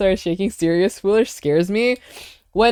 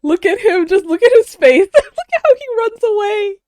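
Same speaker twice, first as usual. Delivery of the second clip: high, giggly, laughter bursting through; laughing excitedly; 4 dB louder than usual. Real, not acted. Streamer watching the gorilla run away, high giggly laughter. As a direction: squeal it out, cracking up at him.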